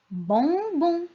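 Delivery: sing-song stretched "bonbon"; sounding out. Shown as a demonstration. Teacher gives children the pictured word, sounding it out.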